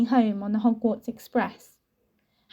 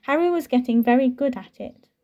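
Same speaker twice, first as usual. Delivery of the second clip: ordinary delivery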